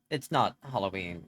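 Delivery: normal talking voice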